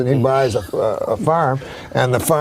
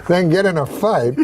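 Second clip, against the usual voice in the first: ordinary talk, nothing marked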